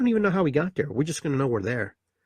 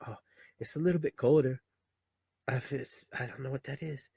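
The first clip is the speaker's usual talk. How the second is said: put-on softer, hushed baby musing; puzzled newborn; 6 dB quieter than usual. Newborn feeling the cold air, quietly puzzled. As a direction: mumble it, a little puzzled. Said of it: "your belief is that everything's okay".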